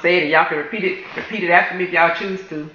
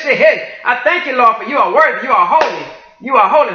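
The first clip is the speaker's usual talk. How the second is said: raised, fervent worship cries; ecstatic praise; 3 semitones higher than usual. Real, not acted. Worshipper praising the Lord aloud, ecstatic and raised.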